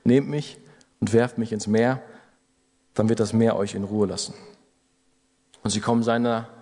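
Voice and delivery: ordinary talk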